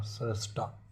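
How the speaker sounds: normal talking voice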